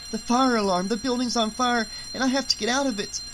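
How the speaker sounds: put-on high, alarmed young boy's thought; alarmed urgency. A narrator's reading. Boy thinking he must escape a fire, high and urgent.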